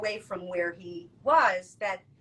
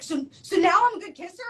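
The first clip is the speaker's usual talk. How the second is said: high, eager imitation of the friend; hopeful friend; 9 semitones higher, 7 dB louder than usual. The friend fishing for praise after the lesson, high and eager. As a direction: pitch him up and hopeful.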